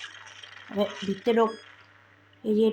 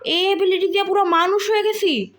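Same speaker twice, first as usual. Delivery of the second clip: excited shouting; 6 semitones higher, 3 dB louder than usual. Real, not acted. Amazed shouting about becoming human.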